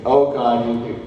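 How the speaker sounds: ordinary talk, nothing marked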